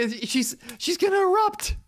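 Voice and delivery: rising to a high squeal; alarmed amusement. Real, not acted. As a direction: build from a stammer to a squealed outburst. Reacts to fuming character.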